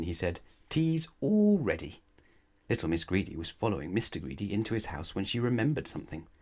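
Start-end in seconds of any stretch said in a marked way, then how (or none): 0.7-2.0 welcoming host. Acted. Mister Greedy welcoming guest.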